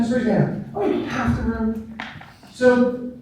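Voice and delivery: ordinary talk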